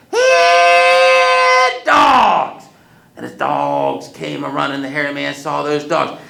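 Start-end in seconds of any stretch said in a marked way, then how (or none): 0.1-2.7 loud high call across distance. Acted. Wiley calling his dogs from the tree, loud holler. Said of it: "cupped his hand ... and said".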